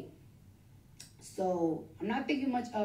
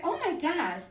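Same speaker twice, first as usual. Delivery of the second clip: put-on loud Staten Island girl; 7 semitones higher than usual. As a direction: squeal it like the doctor from Staten Island. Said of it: "like, so loud like that".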